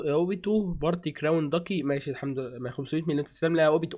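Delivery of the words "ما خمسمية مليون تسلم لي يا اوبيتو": ordinary delivery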